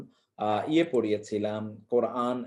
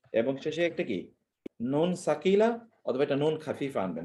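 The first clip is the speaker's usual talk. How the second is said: normal talking voice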